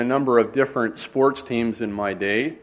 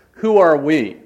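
half yell; 5 semitones higher, 5 dB louder, slower than usual. Retold or imitated. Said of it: "if I was to yell at the top of my voice".

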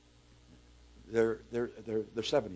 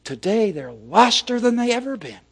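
loud, high-pitched climax; impassioned declaration; 11 semitones higher, 10 dB louder than usual. Retold or imitated. Preacher quoting an elder leader on lost youth, impassioned declaration. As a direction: drive it home at full volume. Quoted climax, then repeated softly.

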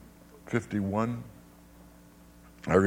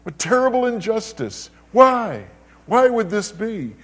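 indignant outcry; 9 semitones higher, 4 dB louder than usual. Retold or imitated. Preacher voicing the crowd's protest, high indignant outcry. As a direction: cry out at the wrong done. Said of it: "We say".

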